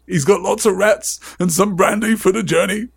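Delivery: muffled, choked-up farewell; muffled Hagrid. Acted. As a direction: play a big softhearted keeper, voice muffled with feeling. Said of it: "said Hagrid in a muffled voice".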